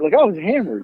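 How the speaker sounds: ordinary talk, nothing marked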